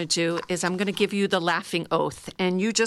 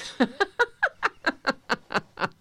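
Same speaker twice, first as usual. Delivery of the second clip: full laugh, a bit higher; louder laugh; 7 semitones higher than usual. Shown as a demonstration. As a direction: laugh out more openly. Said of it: "the louder I get".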